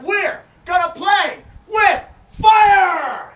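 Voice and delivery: slow, loud showman announcement; hype shout. Real, not acted. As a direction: whip the crowd up. Hyping the crowd.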